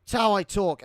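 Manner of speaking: high, loud mock outrage; angry retort. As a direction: snap back in exaggerated, defensive British indignation. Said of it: "I used to get really fucking mad".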